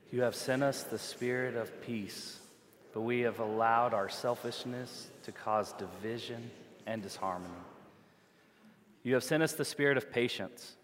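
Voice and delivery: congregation reading together; many voices in unison. Real, not acted. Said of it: "like a responsive reading".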